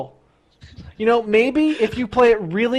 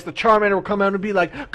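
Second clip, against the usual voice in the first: normal talking voice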